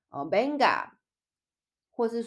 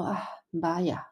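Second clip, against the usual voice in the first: sorry sigh; 4 dB quieter than usual. Shown as a demonstration. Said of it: "那你可能就会说".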